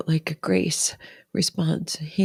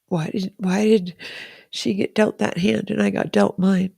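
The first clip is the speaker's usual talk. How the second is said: higher, tearful questioning; tearful blubbering; 6 semitones higher than usual. Real, not acted. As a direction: ask it through real tears. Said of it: "blubbering on".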